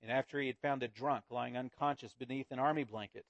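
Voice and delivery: earnest storyteller reading aloud to the congregation; story narration. A narrator's reading. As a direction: read it like a tale told to a child. Preacher reads Wangerin story aloud.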